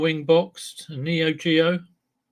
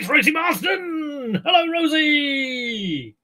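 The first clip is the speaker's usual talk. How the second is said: high, slow excited shout of welcome; excited greeting; 10 semitones higher, slower than usual. Real, not acted. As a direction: call out delighted, like spotting a friend. Greets a viewer who appears.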